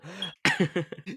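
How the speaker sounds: high giggle; cracking up; amused laughter. Real, not acted. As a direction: giggle helplessly at your own impression. Cracking up at own impression.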